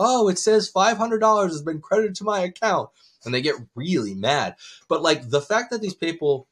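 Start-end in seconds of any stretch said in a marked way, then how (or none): 0.0-2.9 high-pitched, mock-clueless put-on voice; feigned innocence. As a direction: play dumb, voice lifted high. Prankster feigns innocence to furious scammer.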